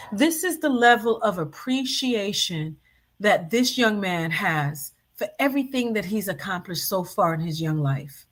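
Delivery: ordinary talk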